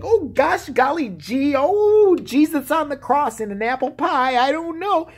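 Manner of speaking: high, slow folksy Southern gush. Retold or imitated. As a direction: ham up a golly-gee Southern churchgoer.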